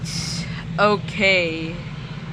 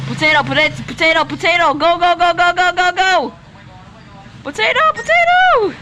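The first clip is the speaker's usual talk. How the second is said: high rushing repeats; urgent excited calls; 6 semitones higher than usual. Real, not acted. Gamer directing his friend in a rush, high urgent calls. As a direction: push him to hurry.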